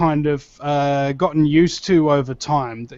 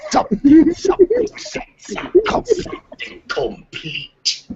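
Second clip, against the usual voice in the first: Emperor voice; 12 semitones higher, slower than usual. Retold or imitated. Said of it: "the Emperor came up with".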